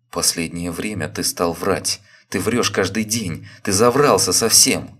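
building from firm to a loud accusing shout; rising to a shout. A narrator's reading. Wife accusing husband of lying, building to a shout. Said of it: "Всё больше повышая голос, заговорила, закричала".

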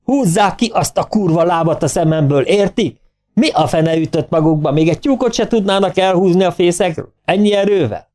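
magas hangú, dühös szidás; felháborodott kiabálás, teljes hangerő nélkül; teli torkú üvöltés. A narrator's reading. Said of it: "aztán teli torokból felüvöltött". (felolvasott dühkitörés a segédek felé)

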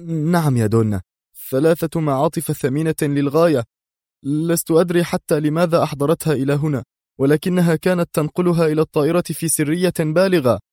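high, eager informant; anxious pitch held high throughout; hasty nervous answer. A narrator's reading. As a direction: pour out details to appease the boss. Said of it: "أجاب في سرعة".

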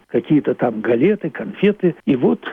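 ordinary talk

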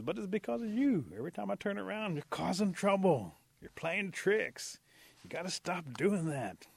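high, loud, drawn-out complaint; exasperated blame. Acted. Possum blaming Rabbit for his trouble, exasperated. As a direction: scold your troublesome friend.

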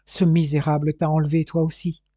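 usual speaking voice

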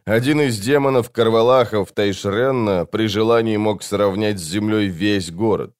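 usual speaking voice

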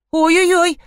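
high, frightened wail; trembling fright. A narrator's reading. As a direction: yelp as if scared. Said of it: "затряслась Юлечка". (испуганно, высоким голосом)

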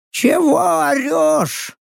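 very high, drawn-out owl screech; cranky high-pitched bird voice; sullen mechanical owl. A narrator's reading. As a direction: screech it grumpily, stretching the words. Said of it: "Мрачно поинтересовалась у меня механическая сова".

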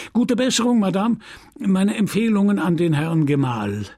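high, hearty parting call; called-out farewell. A narrator's reading. Priest taking leave of Madame to return to pupils, high called farewell. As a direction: call a goodbye while heading off. Priest hurries off, calling his goodbye.

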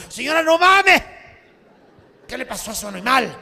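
loud, high outburst of a stunned doctor; doctor's loud high disbelief; shocked doctor. Acted. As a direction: blurt it out, stunned, at full volume.

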